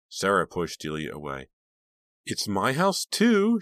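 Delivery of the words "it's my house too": high, loud bratty sneer. A narrator's reading. Sarah defying her sister, sneering. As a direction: sneer it, pitch climbing to the end. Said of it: "she sneered".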